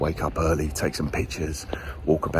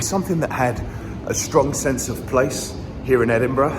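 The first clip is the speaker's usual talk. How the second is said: winded, restarting in short bursts; out of breath; 7 semitones higher than usual. Real, not acted. Vlogger finishing the bookstore story on a climb, out of breath. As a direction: carry on talking while puffed. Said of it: "I'm getting out of breath".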